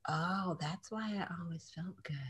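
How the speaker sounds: very soft, as if to oneself; quiet realization. Retold or imitated. Realizing ten years later why meat felt good, hushed realization. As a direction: drop to a hushed dawning aside.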